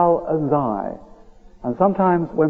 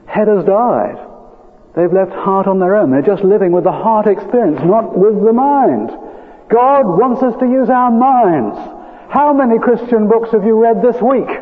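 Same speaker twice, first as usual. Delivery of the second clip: higher, forceful pulpit challenge; emphatic preaching; 6 semitones higher than usual. Real, not acted. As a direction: press the congregation with urgent conviction.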